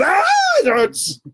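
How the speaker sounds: high, loud drawn-out wail; imitated shriek of pain; mock pained scream. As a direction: scream it like a child fearing the needle. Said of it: "these kids that start screaming".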